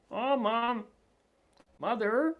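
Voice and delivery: put-on high-pitched child's protest, drawn out; whining child. Child objecting to mom's order about the room, high mock whine. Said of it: "who says to the child".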